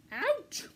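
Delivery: high sharp cry of pain; pained yelp. A narrator's reading. Hidden pack rat yelping in pain behind Mouse, high yelp. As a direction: yelp as the prickers jab. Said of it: "said a voice behind him".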